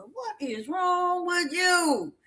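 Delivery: drawn-out, high mock exasperation; exasperated. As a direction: stretch it out high and slow in disbelief. Retold exasperated reply to crying woman.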